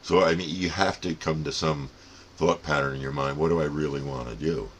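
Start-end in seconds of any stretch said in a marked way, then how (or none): none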